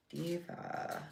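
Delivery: very soft, rising "Diva"; breathy diva whisper. Pretend. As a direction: breathe "Diva" softly, lifting up. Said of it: "Diva time".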